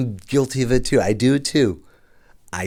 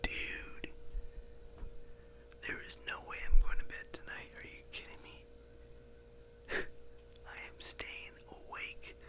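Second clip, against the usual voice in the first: scared whisper; 11 semitones lower, 10 dB quieter than usual. Real, not acted. Spooked by footsteps in a shady hotel, scared whisper. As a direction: whisper it like something's outside the door.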